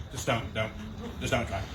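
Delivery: low, soft deadpan dismissal; mock rejection muttered low; deadpan brush-off. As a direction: let them down flat and quiet.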